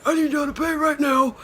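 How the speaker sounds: high, loud repeat of the demand; imitating the attendant, loud and high; stern parking attendant. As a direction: insist again in the attendant's voice. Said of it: "he's like".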